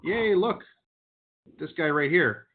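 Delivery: high, loud cheer; excited. Real, not acted. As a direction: cheer the good news. Celebrating a yes on call.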